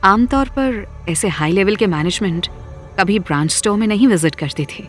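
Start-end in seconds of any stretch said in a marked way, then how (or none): none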